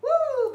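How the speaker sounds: high, loud whoop of effort; loud woo. Real, not acted. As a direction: let out a big whoop. Exertion cry after the set.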